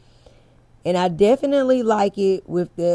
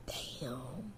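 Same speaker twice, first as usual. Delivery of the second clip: breathy unvoiced whispered damn; a whispered curse under the breath; 7 semitones lower, 23 dB quieter than usual. Real, not acted. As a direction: drop it to a private whisper. Quiet aside about the hair.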